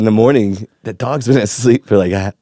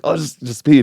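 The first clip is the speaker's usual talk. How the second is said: high, whiny-toned coaxing; mock desperate begging; exasperated pleading; 8 semitones higher than usual. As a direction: plead with a stubborn dog. Pleading again with dog.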